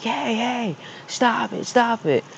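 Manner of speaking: high-pitched yell held back in volume; high, restrained mock shout; mock teacher yell. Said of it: "she's yelling at me".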